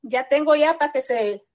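ordinary talk, nothing marked

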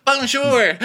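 very high, loud "Bonjour"; mock French squeal. Guys answering Asher, high loud mock greeting.

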